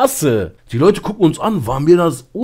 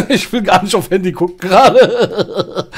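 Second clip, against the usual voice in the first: real laughter spilling through the words; laughing; 5 semitones higher, 4 dB louder than usual. Real, not acted. Streamer laughs off a creepy chat message, laughing through words. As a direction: laugh nervously while trying to talk.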